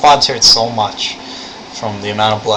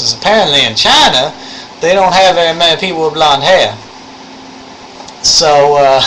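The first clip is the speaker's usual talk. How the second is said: raised, animated Southern storytelling voice; thick Southern accent; 5 semitones higher, 3 dB louder than usual. Shown as a demonstration. Showing viewers how the lab instructor talks, thick Southern accent. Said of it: "only with a thick Southern accent".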